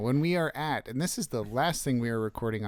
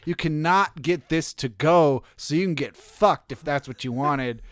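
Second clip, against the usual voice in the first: loud, punchy beer-ad announcer; erratically punched radio read; 6 dB louder than usual. Pretend. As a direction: belt out a mock radio commercial. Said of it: "in your most radio voice".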